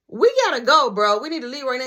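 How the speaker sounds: acted impatient insistence; retold urgent plea. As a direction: urge a friend to get moving. Acted urging friend to leave.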